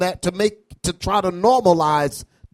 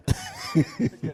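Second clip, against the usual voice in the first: stifled giggles; 8 dB quieter than usual. Real, not acted. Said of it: "Go ahead and laugh".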